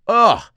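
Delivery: loud high disgusted ugh; grossed-out loud ugh; disgusted groan. Acted. Disgust at fart story.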